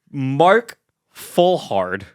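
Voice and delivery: slow, separated "Mark ... Fullhard"; deliberate enunciation. Real, not acted. Correcting co-hosts on the therapist's name, slow and deliberate. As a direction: spell it out slowly, name by name. Settling dispute over the man's name.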